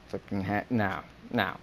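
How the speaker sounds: normal talking voice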